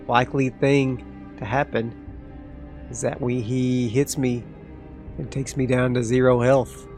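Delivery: ordinary delivery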